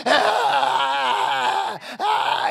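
loud breathy cries; the possessed man crying out; loud strained ahs; demon's cry. Retold or imitated. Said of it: "he's like".

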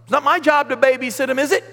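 high, loud defiant snapping; defiant Cain. Acted. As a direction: dismiss God with loud defiance. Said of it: "And he's like".

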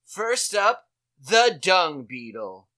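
loud, slow mock show-host intro; hammed-up nature show narrator; mock announcer. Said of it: "They're like".

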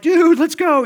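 high, loud pumped-up "Dude, let's go"; dad cheering on his son, excited; excited shout. Retold or imitated. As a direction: hype it up like a thrilled dad. Dad's excited retold reply.

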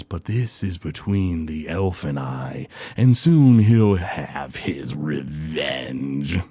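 very slow, low and soft gloating; villain promising revenge, low and slow; sinister villain. Acted. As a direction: drag it out low and quiet like a threat. GM voices Groon.

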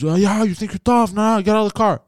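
mock tough guy. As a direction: sneer it out like a guy picking a fight. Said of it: "He goes".

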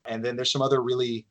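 usual speaking voice

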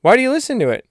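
hammed-up high-pitched bewildered friend; squeaky Doug. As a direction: squeak it out in disbelief. Doug asks in the skit.

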